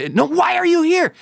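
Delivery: high, insistent mimicked woman's voice; Italian lady. Italian lady questions the pilgrimage leader, high urgent imitation. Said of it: "I can't do the Italian accent".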